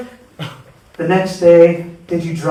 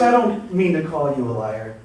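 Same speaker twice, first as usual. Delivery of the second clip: ordinary talk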